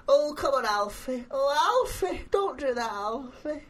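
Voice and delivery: high, wailing soap-nana impression; Nana Moon. Doing a party-piece voice for his co-hosts, Nana Moon impression. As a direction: plead up high, dying away on each name. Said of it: "Can I do Nana Moon?".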